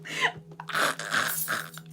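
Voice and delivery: breathy laugh. Real, not acted. Laughs at chat comment.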